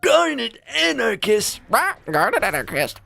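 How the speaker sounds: high, slow stretched name call; drawn-out silly voice. Pretend.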